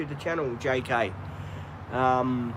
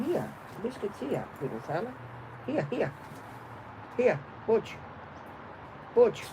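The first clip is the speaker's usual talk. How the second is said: coaxing dog voice; 6 semitones higher, 7 dB quieter than usual. Real, not acted.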